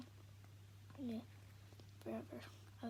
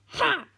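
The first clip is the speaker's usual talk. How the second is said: a loud, fake, scornful "Ha"; bitter snort laugh; 8 semitones higher, 18 dB louder than usual. Dad scoffs at Tom with a bitter snort. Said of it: "snorts Dad, but it's not a real laugh".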